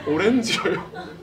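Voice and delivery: 오렌지예요 drawn out slowly, laughing; slow savoring repeat. Retold or imitated. Retelling the chairman's word slowly, laughing. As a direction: relish each syllable of 오렌지예요 with a laugh. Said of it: "평생 잊지 못할 말".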